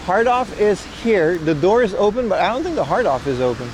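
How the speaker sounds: ordinary talk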